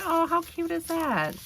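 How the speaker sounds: high excited exclamation; thrilled by the little postcard; delighted squeal. Real, not acted. Admiring the gift postcard, high delighted squeal.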